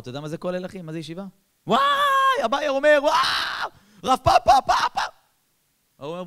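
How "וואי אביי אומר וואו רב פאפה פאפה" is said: very high, loud mock study-hall shouting; acted screaming. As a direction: bellow as if arguing Talmud at full volume. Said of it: "הוא שומע צרחות".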